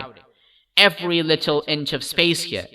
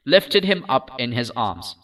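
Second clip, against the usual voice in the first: ordinary delivery, nothing marked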